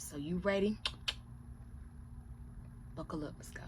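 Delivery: ordinary talk, nothing marked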